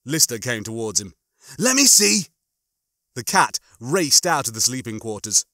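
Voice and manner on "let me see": raised, higher and louder voice; urgent demand. A narrator's reading. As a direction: call out urgently to get a look. Lister urgently approaches injured cat.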